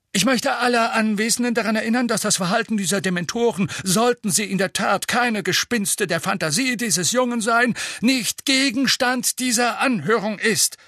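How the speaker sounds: fast, pompous minister; pompous official. A narrator's reading. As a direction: rattle it off like a self-important official. Fudge voiced in audiobook.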